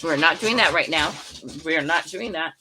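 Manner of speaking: loud, firm yelling to stop a dog fight; real loud scolding of the dogs; shouting at dogs. Real, not acted. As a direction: bark it out to break up the fight.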